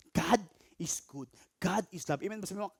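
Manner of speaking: usual speaking voice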